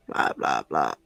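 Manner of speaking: whispered low blah blah; breathy whispered catchphrase; Crow voice. Retold or imitated. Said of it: "needing him to say blah, blah, blah".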